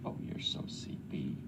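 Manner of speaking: breathy whisper to a sleepy kitten. Real, not acted. Driver whispering to the dozing kitten in his van. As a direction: whisper it so she stays asleep.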